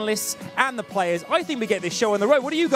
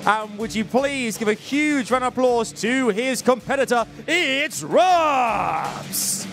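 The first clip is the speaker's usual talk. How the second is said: booming pumped announcer; 5 semitones higher, slower than usual. Acted. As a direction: ring announcer hype call. Introducing second competitor to crowd.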